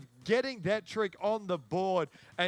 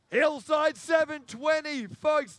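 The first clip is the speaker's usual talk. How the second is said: much higher and louder, bursting with excitement; excited shout; 8 semitones higher, 5 dB louder than usual. Real, not acted. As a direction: shout the trick name as it lands. Big trick lands, commentator erupts.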